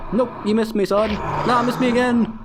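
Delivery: high, loud mocking jeer; cocky taunt. Player taunts the creatures she just dodged, high mocking jeer. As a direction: jeer at the monsters that missed you. Taunting monsters while dodging.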